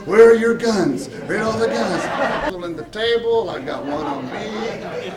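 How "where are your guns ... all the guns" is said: louder, higher, frantic retold question; urgent demand. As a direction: demand it like a panicked wife. Wife urgently asking for guns.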